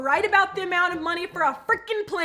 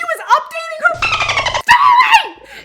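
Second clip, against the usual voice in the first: much higher, much louder, slow outraged shout; 17 dB louder, slower than usual. Real, not acted. Telling podcast partner about the scam, outraged shout. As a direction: shout the punchline in disbelief.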